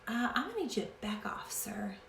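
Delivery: whispered, quick, possessive; mock warning whisper. Wife warning her husband off her oil, in a whisper. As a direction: hiss him away playfully.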